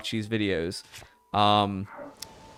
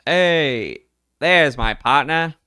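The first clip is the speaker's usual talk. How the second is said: lightly southern reporter voice, easygoing; southern accent; 4 semitones higher than usual. Acted. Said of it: "now it's going really southern".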